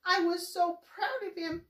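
high, loud sobbing lament. Acted. Ma grieving her son to Pa, sobbing high and loud. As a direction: cry out the grief for the lost son. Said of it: "he sobbed".

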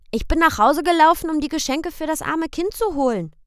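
put-on high, loud little boy's question; high child's voice; child asking. A narrator's reading. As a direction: ask papa like a worried small boy. Boy Michel reports.

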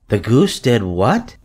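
mild disbelief. A narrator's reading. Missus Arable questions her daughter, mildly worried disbelief. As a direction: lift the last word in mild alarm. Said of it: "gazing ... with a queer, worried look".

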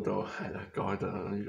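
ordinary delivery

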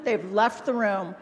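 ordinary talk

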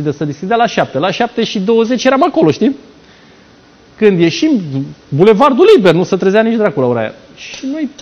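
ordinary talk, nothing marked

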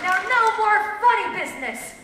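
quick, high, loud warning; bandit's warning. A narrator's reading. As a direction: warn them sharply as the robber. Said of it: "warned the bandit".

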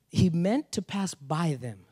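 slow, weighing each word of "He meant to pass by them"; slow emphatic reread. Shown as a demonstration. Pastor rereads the verse to stress it, slowly. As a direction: let "meant" land slowly and deliberately.

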